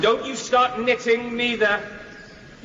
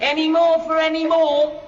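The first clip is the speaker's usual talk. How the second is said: high, carrying tram conductor call; conductor's call; 5 semitones higher, 3 dB louder than usual. Acted. [name] mocking the tram conductor's call. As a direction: sing it out to the passengers.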